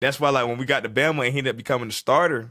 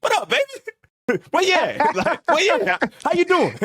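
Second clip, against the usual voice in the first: cheery greeting; 14 semitones higher than usual. Retold or imitated. Teammate already in the locker room greets him in a high, cheery voice. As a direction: greet him like you've been here for hours.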